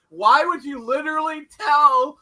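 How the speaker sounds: high, loud and drawn-out disbelief; incredulous demand. Retold or imitated. Host berating a character for giving away a secret, incredulous. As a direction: demand an answer as if you can't believe the stupidity.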